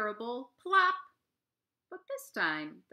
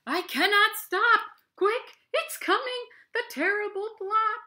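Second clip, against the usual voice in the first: very high, loud panicked shout; bear crying back; 13 semitones higher, 11 dB louder than usual. Acted. Big brown bear crying back in panic as he runs. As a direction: yell it in comic terror while fleeing. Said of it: "The bear cries back".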